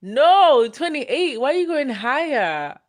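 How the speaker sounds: high, loud protest no; playfully wounded, call it out; mock outrage. Real, not acted. Protesting to a viewer who guessed too old, high loud mock outrage.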